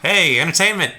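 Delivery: high, called-out sentry voice; acted impatient hailing; guard summoning others. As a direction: call your fellow guards over.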